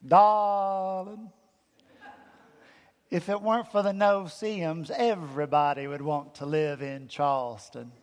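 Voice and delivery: a gracious old Southern belle from Charleston, lightly voiced; Charleston accent. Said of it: "in her best Charleston accent".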